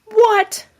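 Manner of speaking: high, loud "what?"; shocked disbelief. Real, not acted. Reviewer stunned by the Gargoyle Queen reveal, loud high "what?". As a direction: jump up in pitch, stunned.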